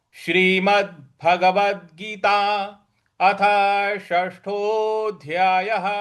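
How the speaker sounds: slow, measured Sanskrit chanting; solemn recitation. Acted. Teacher begins the reading of the chapter, slow solemn recitation. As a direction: announce the chapter like a sacred reading.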